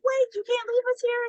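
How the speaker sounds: high-pitched mock plea; pleading chaperone. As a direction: call after him, imitating mild panic. Chaperone chasing quitting driver.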